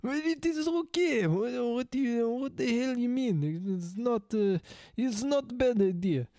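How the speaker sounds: high-pitched muddled mock-Russian accent; Indian-Russian accent. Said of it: "Indian Russian".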